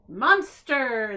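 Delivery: loud triumphant cheer. A narrator's reading. The little terrors cheering their monster, loud cheer. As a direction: cheer it out together. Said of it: "they all cheered together".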